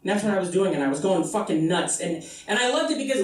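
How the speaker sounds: normal talking voice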